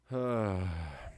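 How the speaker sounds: long falling sigh; drawn-out, weary exhale; resigned sigh. Real, not acted. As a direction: let the breath out in resignation. Waiting for stream to return.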